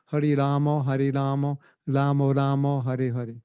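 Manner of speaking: Bengali-accented chant, "Kisno" and "Lamo". Shown as a demonstration. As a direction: chant as in Bengal, rounded final vowels. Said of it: "That's Bengal".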